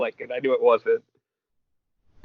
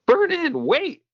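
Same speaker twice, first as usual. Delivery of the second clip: mock-shout it way up high; acted yell; slower than usual. Midoriya yelling to stop someone, high acted yell. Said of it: "and he yells".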